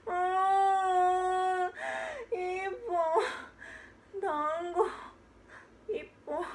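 put-on sniffle the request like a pouting kid; whimpering child. Child keeps begging.

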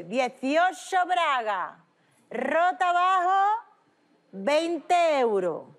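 slow, drawn-out street hawker cry; market vendor call. Retold or imitated. Imitating a Cádiz market vendor crying his wares. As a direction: call it out like a market stall seller. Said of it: "decía un señor, yo escuché".

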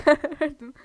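usual speaking voice

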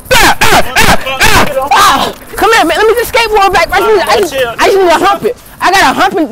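loud high frantic pleas; frantic shouting. Acted. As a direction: shout it in a frenzy.

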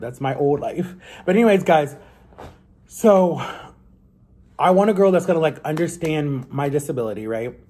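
ordinary talk, nothing marked

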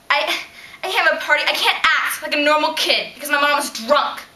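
angry confrontation. Acted. Teen confronting her drunk mom, angry. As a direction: let the hurt out at full volume.

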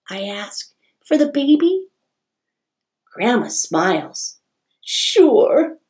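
bright, lively storybook reading; reading to children. A narrator's reading. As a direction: read it like story time for kids. Reads picture book aloud.

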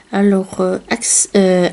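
usual speaking voice

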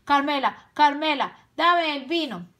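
high, loud urgent command; ordering. A narrator's reading. Tío Lobo demanding wine from Carmela, loud urgent order. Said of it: "ordenó".

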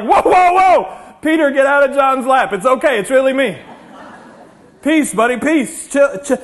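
loud, high, startled-crowd holler; urgent calming shout. Acted. Preacher as Jesus settling panicked Peter and friends, urgent shouting. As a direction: talk frantic friends down loudly. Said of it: "He showed up and was like".

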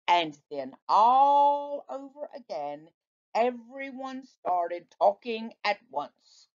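ordinary delivery

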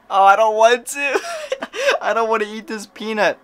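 high, loud whiny laugh-cry; half laughing, half sobbing in dread; I don't want to, laughing through tears. Real, not acted. Dreading the peanut, laugh-crying.

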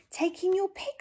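high bright voice for the wise man; wise old sage. Acted. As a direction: give the advice cheerfully. Wise old man's advice.

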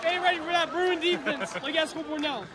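usual speaking voice

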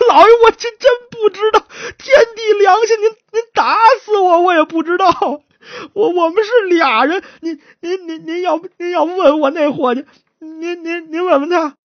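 high, stammering acted wail; tearful pleading. Beaten suspect begging the magistrate to ask his partner, wailing. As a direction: sob out desperate oaths of innocence.

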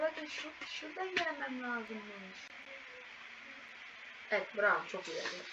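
ordinary talk, nothing marked

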